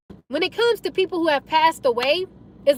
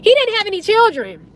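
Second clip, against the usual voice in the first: normal talking voice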